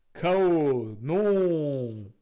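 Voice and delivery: loud dramatic protest; dramatic cry. Acted. Streamer voicing a character shouting no, loud. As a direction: cry out in mock alarm. Dramatic in-game outburst read.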